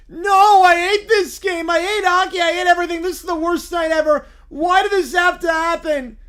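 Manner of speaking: put-on a sulking kid about to lose it; upset whiny bear. Bear melts down.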